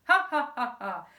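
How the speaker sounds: loud spelled-out ha ha; mock laugh. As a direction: henchman thumbs joining the laugh. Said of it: "Then thumbs".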